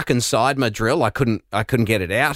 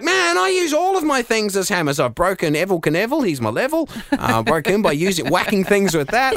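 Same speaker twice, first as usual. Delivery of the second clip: high, animated mock rant; excited rant; 7 semitones higher than usual. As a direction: rattle it off, higher and excited. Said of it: "And I was like".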